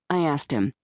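usual speaking voice

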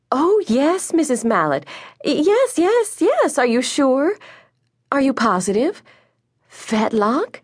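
high, startled phone voice; questions rising in dismay; flustered disbelief. A narrator's reading. Mother hears a complaint about her son, flustered disbelief.

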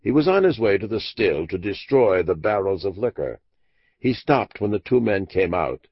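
usual speaking voice

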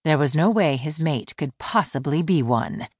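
plain reading voice

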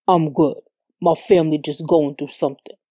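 low, lightly voiced man's reply; drunk boyfriend. A narrator's reading.

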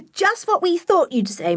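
put-on high, merry girlish reply; amused child. A narrator's reading. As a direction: tease mother in a high child's voice. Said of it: "The children laughed".

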